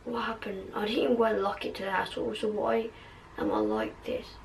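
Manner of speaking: very soft, hushed questioning; said quietly. Acted. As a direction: ask it almost under your breath. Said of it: "Ron said quietly".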